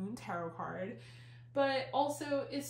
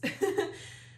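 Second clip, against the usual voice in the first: breathy laugh; 9 semitones higher than usual. Real, not acted. Laughs at own body.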